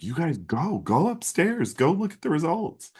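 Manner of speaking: high, eager imitation of mom; excited mom. Mom excitedly sends them upstairs.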